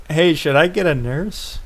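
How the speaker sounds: lightly voiced girl asking if he needs a nurse; worried high-pitched. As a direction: ask it with high worry. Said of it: "The worried, high-pitched voice of the girl".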